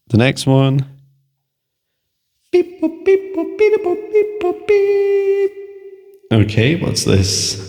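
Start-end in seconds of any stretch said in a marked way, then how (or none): 2.5-5.5 high-pitched beep boop sound effect; robot beeping. Pretend. Random picking sound effect. 6.3-7.6 slow words with echo; echo effect. Real, not acted. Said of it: "Oops, turn off the echo".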